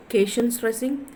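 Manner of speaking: usual speaking voice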